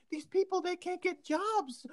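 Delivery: high-pitched mock pleading; hammed-up high voice of complaint; pleading woman reporter. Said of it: "she's like".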